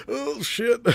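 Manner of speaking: high squeaky mock panic; mock alarm. A crewman gasping in alarm on a planet with no air. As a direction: squeak it out like you're suddenly choking. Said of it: "be like".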